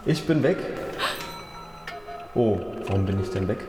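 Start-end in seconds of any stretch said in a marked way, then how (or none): none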